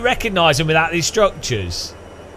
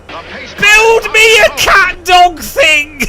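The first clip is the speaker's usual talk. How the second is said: much higher, louder, very slow command; whimsical pharaoh; 9 dB louder, much slower than usual. Retold or imitated. Pharaoh ordering a sphinx from his builders, high and slow. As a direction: stretch out a silly royal order. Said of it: "one of them was like".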